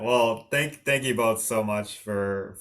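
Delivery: ordinary delivery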